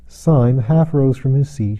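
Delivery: usual speaking voice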